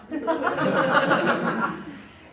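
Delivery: a loud burst of laughter; laughing. Real, not acted. Teacher laughs at her own sweet tooth, loud laugh.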